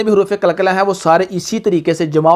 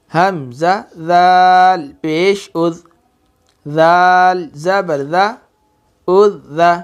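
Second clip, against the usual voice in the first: slow drawn-out spelling; much slower than usual. Shown as a demonstration. Teacher modelling the correct slow recitation. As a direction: spell slowly, stretching ज़ाल. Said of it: "मैं इसको कैसे पढ़ता हूँ".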